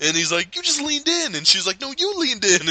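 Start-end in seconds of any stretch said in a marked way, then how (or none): 0.5-1.3 high-pitched bickering; mock accusation. Acting out the quarrel. 1.8-2.7 high-pitched squabbling comeback; mock retort. Acting out the quarrel.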